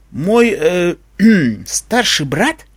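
slow, halting question with throat-clearing; stunned. A narrator's reading. Unicorn hears she has a brother, stunned. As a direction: stumble over it in disbelief.

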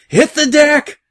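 high, loud scream to take cover; urgent high-pitched shout; screamed warning. A narrator's reading. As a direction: scream the order to the whole crew. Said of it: "the first mate screamed".

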